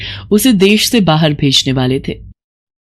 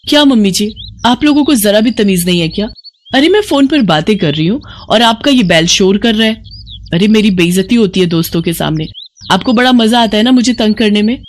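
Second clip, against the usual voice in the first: angry scolding; 4 semitones higher than usual. Acted. Angry bride accusing her mother-in-law of spite. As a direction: berate her, raised and sharp.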